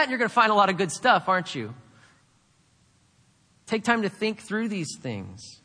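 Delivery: ordinary talk, nothing marked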